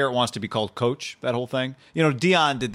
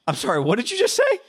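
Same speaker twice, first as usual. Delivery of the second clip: incredulous; 10 semitones higher, 4 dB louder than usual. Retold or imitated. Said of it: "you have to, like".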